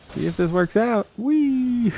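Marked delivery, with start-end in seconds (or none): 1.2-1.9 high squeal of glee; gleeful whee. Real, not acted. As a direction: whoop it out going down the slope. Sliding down a drop in game.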